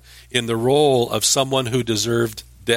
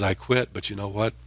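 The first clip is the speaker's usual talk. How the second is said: softly and lower, like a secret admission; hushed confession; 3 semitones lower, 8 dB quieter than usual. Retold or imitated. Jeremiah quietly admitting the message still burns inside, softer. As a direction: drop the voice, inward and intimate. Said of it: "So Jeremiah says".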